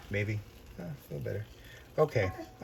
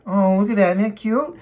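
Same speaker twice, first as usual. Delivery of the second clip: ordinary talk, nothing marked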